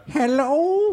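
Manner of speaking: high, frail mock "hello" voice; imitated feeble phone answer. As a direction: play someone struggling to answer the phone.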